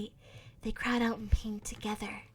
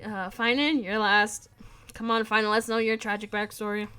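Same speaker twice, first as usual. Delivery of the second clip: calling out; 8 dB louder than usual. Real, not acted. Calling the next reader.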